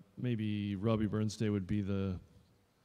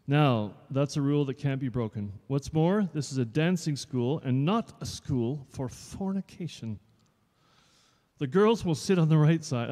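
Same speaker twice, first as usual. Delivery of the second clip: louder, a touch higher stern teacher; strict dance teacher; 4 semitones higher, 7 dB louder than usual. Acted. Dance teacher addressing new pupils, mildly stern. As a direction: lay down the rules.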